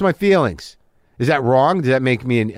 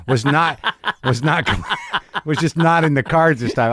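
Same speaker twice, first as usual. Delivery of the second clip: laughing. Real, not acted. Laughing mid-sentence.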